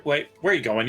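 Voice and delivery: normal talking voice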